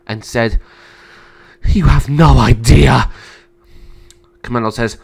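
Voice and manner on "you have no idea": loud outburst of fear and anger; tearful anger. Acted. Sister crying at the emperor, frightened and angry. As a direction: throw it out through fearful, angry tears. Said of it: "cry with both fear and anger".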